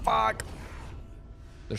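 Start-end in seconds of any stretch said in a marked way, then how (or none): 0.0-0.4 sharp high frustrated curse. Real, not acted. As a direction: spit it out as the combo lands. Combo of hits lands.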